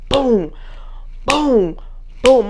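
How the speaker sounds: spat-out boom noises for each punch; punch sound effects. Retold or imitated. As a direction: mimic the punches with sharp booms. Acting out punching the girl.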